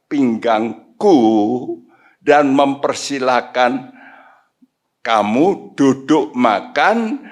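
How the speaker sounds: slow, loud solemn declaration; Jesus. Acted. Lord promising to serve his servants, slow emphatic proclamation. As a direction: proclaim each phrase with drawn-out weight. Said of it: "ini Tuhan Yesus ngomong".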